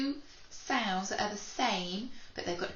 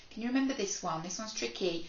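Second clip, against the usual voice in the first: ordinary delivery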